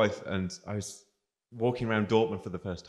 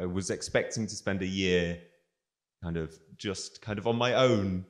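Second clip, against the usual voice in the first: ordinary talk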